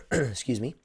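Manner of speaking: usual speaking voice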